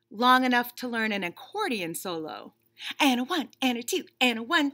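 lightly voiced bunny, impatient and fed up; a put-upon bunny stuck waiting; bored bunny. Bunny complaining to the reader about waiting, mildly whiny.

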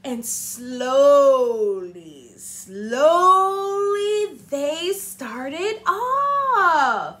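very slow, stretched words; drawn out slowly. A narrator's reading. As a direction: stretch each word like the train crawling. Engine straining up.

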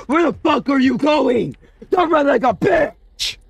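high screaming after the fleeing bunny; furious yell. Real, not acted. Gamer yelling at the bunny running away, furious. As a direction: yell it down the hall.

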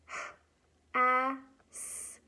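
h, a, s spelled letter by letter; sounding out letters. Shown as a demonstration. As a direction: say each letter h, a, s slowly. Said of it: "if you sound out the letters".

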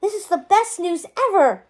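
high-pitched burst of glee; gleeful. A narrator's reading. Merlin clapping his hands over the news, gleeful. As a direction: exclaim it with delight. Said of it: "clapping his hands together with glee".

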